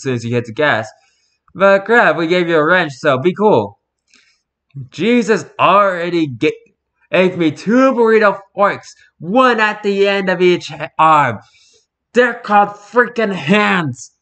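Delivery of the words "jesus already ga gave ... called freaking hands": loud, slow, disbelieving rant about forks; exasperated rant. Real, not acted. Venting at the burrito fork eaters, exasperated and loud. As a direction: throw your hands up at the absurdity.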